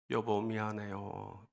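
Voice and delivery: low, slow, very soft murmur; hushed apology. Retold or imitated. Wife apologising in her husband's arms, hushed and slow. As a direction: apologise gently, drawing the words out.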